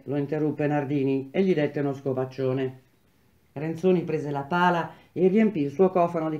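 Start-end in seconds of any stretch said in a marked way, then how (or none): none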